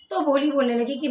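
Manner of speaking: ordinary delivery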